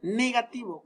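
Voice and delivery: normal talking voice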